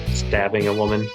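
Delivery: usual speaking voice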